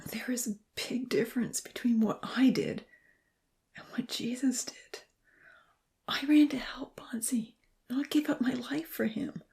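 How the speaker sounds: high, strained whispered protest; anguished whisper. A narrator's reading. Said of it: "In an anguished whisper".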